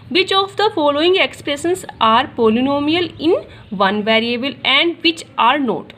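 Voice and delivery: reading the question out very slowly for students to write; teacher dictating the question word by word; slow dictation. Shown as a demonstration. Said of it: "question पढ़िए पहला".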